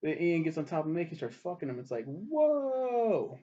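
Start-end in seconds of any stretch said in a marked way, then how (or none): 2.0-3.4 long, high stunned whoa; drawn-out mock-shocked whoa at the screen. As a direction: gasp it out as if the twist just hit you. Reacting to the sex scene.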